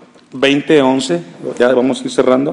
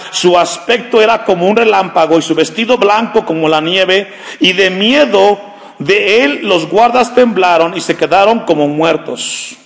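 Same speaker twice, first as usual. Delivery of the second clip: raised, slightly higher preaching read; solemn Bible reading, building toward the end; scripture reading; 5 semitones higher, 4 dB louder than usual. Acted. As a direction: read the Gospel out to the church. Preacher reads the Gospel aloud.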